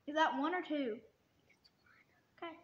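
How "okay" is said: ordinary talk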